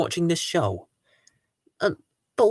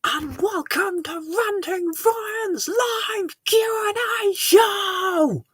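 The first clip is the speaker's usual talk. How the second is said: high, slow, drawn-out announcer welcome; show host intro; 11 semitones higher, 3 dB quieter, much slower than usual. Acted. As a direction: sing out the show opening. Opening the live show.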